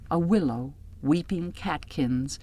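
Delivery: ordinary delivery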